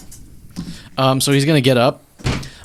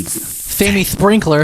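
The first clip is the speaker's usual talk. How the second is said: silly high slow voice; 8 semitones higher, much slower than usual. Acted.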